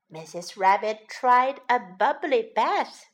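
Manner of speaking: usual speaking voice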